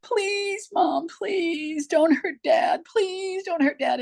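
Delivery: high, drawn-out tearful pleading; imitating crying children. Children begging their mom to spare their dad, crying. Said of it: "they're crying and going".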